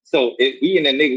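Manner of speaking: ordinary talk, nothing marked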